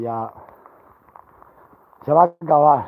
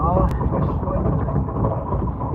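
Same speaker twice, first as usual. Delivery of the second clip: slow breathy yawn of a sigh; sleepy; 4 dB quieter, much slower than usual. Real, not acted. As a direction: drag it out half asleep. Tired sigh.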